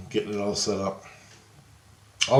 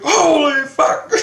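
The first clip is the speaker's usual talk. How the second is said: high, loud, drawn-out mock yell; retold freak-out; 8 dB louder, much slower than usual. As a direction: act out someone shocked out of their wits. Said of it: "He's freaking out".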